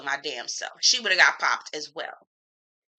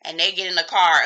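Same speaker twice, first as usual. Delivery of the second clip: usual speaking voice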